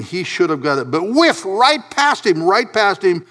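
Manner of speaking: high-pitched, impassioned sermon delivery; full-force preaching. Real, not acted. Preacher on the trained theologian who should have known, high and impassioned. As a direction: hammer it home in exasperation.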